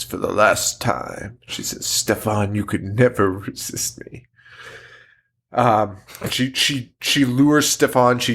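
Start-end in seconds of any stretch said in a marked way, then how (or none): 0.0-1.4 low, slow, ominous quote; imitated grave movie line, drawn out low; mock-dramatic film villain. 1.9-4.3 mock seductress. Acting out film character's line.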